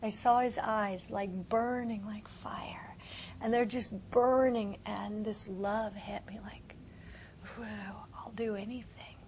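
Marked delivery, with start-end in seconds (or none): 1.1-3.0 hushed unvoiced awe; whispering in awe of the vision; awed whisper. Real, not acted. 4.9-9.2 hushed unvoiced awe; whispering in awe of the vision; awed whisper. Real, not acted.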